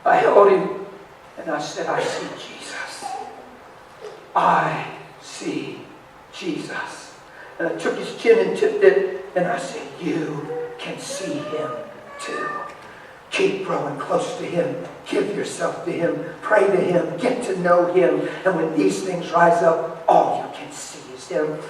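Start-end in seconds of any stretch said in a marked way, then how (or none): 1.9-7.1 hushed, slow, almost whispered; tender assurance. Retold or imitated. Father holds his sobbing son and testifies, hushed and tender. 10.0-21.6 slow, soft fatherly counsel; gently encourage, giving each phrase time; tender urging. Retold or imitated. Father tips son's chin and urges him on, slow and tender.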